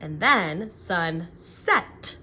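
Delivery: sunset said slowly by syllable. Shown as a demonstration. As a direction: stretch out each syllable slowly. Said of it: "What's the second syllable?".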